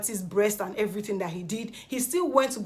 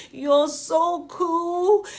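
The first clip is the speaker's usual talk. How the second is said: high, loud, drawn-out gush; gushing compliment; 8 semitones higher, 6 dB louder, much slower than usual. Retold or imitated. Nengi greeting Dorothy at the party, gushing. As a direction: stretch it out in a high imitated voice. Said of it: "She was like".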